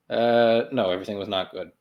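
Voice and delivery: ordinary talk, nothing marked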